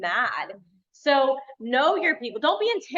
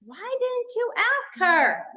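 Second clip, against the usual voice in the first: higher, slow, incredulous question; disbelieving that they never asked; exasperated; 9 semitones higher, slower than usual. Retold or imitated. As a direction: coach imitating herself scolding clients. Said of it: "I'm like".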